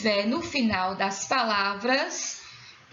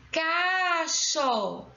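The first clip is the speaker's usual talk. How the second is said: "Cacho" pitched up, first syllable stretched; drawn-out syllables; 4 semitones higher than usual. Shown as a demonstration. Teacher showing the stressed syllable, "Cacho" drawn out. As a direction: draw out "Cacho" slowly. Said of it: "Qual é a sílaba tônica?".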